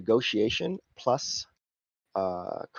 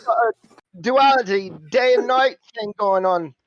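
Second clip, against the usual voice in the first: higher, louder cheeky cockney; cockney fixer; 11 semitones higher, 6 dB louder than usual. Acted. Simon flattering the player's face, cheeky cockney. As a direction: flatter them with a cheeky grin.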